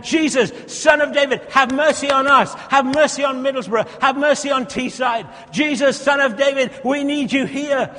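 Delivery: loud, high pleading; fervent cry. Acted. Preacher crying out for Middlesbrough and Teesside, loud and fervent.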